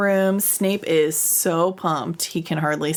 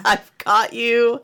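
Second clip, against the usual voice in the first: triumphant; 5 semitones higher, 4 dB louder, slower than usual. Retold or imitated. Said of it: "he's blinded by excitement".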